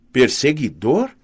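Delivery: "Perseguidor" drawn out with rising tune; questioning echo. A narrator's reading. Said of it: "Carter repetiu". ("Perseguidor" repetido devagar, como pergunta)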